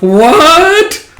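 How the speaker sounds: very high, loud rising shriek; shocked freak-out. Retold or imitated. Fiona reacting to Lloyd's agreement, shocked shriek. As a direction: blurt it in hammed-up shock. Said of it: "freaks out and shocked with".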